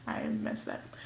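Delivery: very low, soft under-the-breath remark; hushed admission of a slip; whispered aside. Real, not acted. Aside after botching her sign-off.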